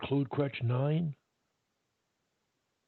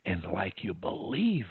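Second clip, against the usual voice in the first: lower, softer, controlled delivery; calm; 4 semitones lower, 4 dB quieter than usual. Shown as a demonstration. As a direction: speak calm and clear, no pressure. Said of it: "You come off controlled, happy, and really clear".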